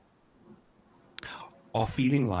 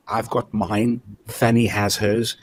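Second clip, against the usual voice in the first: ordinary talk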